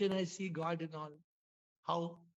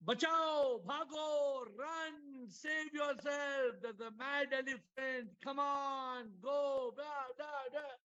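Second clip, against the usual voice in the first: panicked shouting; 6 semitones higher, slower than usual. Retold or imitated. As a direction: yell at everyone to run from the elephant. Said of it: "And everybody screaming".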